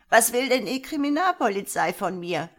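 put-on high, very loud old-woman voice; loud croaky old woman. A narrator's reading. Old woman challenging police over the intercom, loud and croaky. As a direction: demand it loudly in an old croak. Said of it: "krächzend und sehr laut durch die Sprechanlage".

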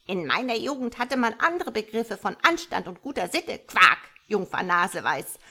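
loud indignant frog lecture; incensed. A narrator's reading. Frog mother lectures on her youth, incensed. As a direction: scold the saucy youngster with emphatic force. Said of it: "erwiderte der Frosch erbost".

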